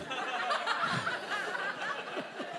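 high breathy laugh. Real, not acted. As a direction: break into real giggles. Laughing at own joke.

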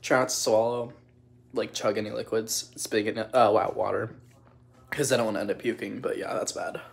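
usual speaking voice

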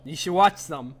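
usual speaking voice

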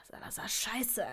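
understated annoyed swearing under the breath; breathy, mildly exasperated oath; annoyed curse. A narrator's reading. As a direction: curse with a touch of irritation. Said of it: "fluchte er genervt".

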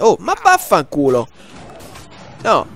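sudden angry outburst; explosive italian cursing. Real, not acted. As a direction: curse loudly in frustration. Gamer raging at driving.

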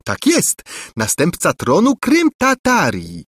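high, teasing echo of his words; mocking repeat. A narrator's reading. Angelika mockingly echoes the prince's words, high and teasing. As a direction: parrot him back mockingly. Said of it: "przedrzeźniając dalej biednego Lulejkę".

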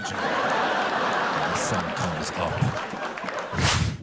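drawn-out whisper, almost no voice; slow teasing whisper. Pretend. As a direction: stretch every word out in a whisper. Stretched slow whisper answer.

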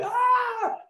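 sudden high mock scream of fright; scared shriek. Shown as a demonstration. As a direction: shriek like someone startled. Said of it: "scared people, they".

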